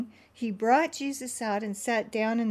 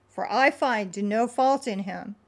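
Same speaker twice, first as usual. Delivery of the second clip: usual speaking voice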